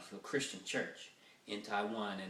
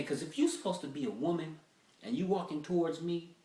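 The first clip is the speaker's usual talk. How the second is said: ordinary talk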